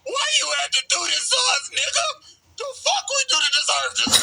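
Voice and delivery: high-pitched, hammed-up wail of complaint; mock distraught protest. Gang leader protesting to Afro after the massacre, mock distraught. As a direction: play an outraged victim, voice shooting up.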